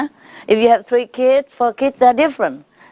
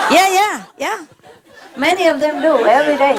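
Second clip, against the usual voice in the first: ordinary talk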